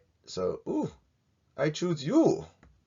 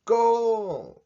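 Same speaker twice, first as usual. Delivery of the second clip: loud, high goal shout; goal call; 13 semitones higher, 7 dB louder than usual. Pretend. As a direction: celebrate like a commentator. Football kick celebration.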